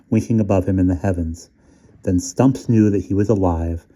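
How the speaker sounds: plain reading voice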